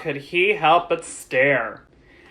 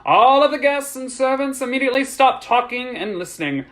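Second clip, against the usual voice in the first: higher, projected public address; formal announcement; 8 semitones higher, 4 dB louder than usual. A narrator's reading. Master of the house instructs guests, projected announcement. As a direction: announce with authority to a crowd.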